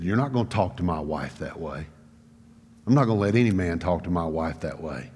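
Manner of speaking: very low, soft, stern warning; serious father. Retold or imitated. Father defending his wife to his son, low and serious. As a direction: drop low and quiet, laying down the law. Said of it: "then I knew it was serious".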